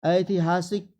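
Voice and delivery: ordinary talk, nothing marked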